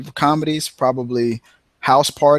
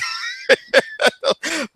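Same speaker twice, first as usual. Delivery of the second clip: high shouting laugh. Real, not acted. Laughing hard at the quote.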